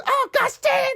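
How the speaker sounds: very high yelp; hammed-up shriek; Dee cursing. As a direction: squeal as the fire hits. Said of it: "She goes".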